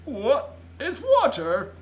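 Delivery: slow, loud, high question; drawn-out bewilderment; baffled giant. A narrator's reading. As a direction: puzzle over it in a big giant voice. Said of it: "frowning mightily".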